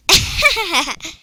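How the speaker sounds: high, loud odd laugh; a strange squeaky giggle; weird laugh. Real, not acted. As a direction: laugh she calls weird. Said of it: "That was a weird laugh".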